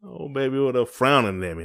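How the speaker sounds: usual speaking voice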